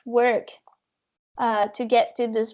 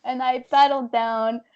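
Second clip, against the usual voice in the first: normal talking voice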